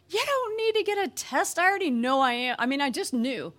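loud, high start, insistent; upset retort. Retold or imitated. Upset wife refusing a pregnancy test, acted exasperation. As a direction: fire back in acted exasperation.

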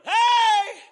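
high, loud shouted Hey; retold call. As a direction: holler at the mama dog. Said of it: "he went over and".

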